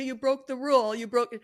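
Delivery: a hammed-up nag; nagging scold. Shown as a demonstration. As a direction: scold the child for breaking the rule. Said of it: "I don't talk like that".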